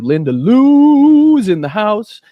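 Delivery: loud, high call with the name drawn out; hyped shout-out. Real, not acted. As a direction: hail an arrival like an announcer, stretching the name. Announcing a viewer's arrival in chat.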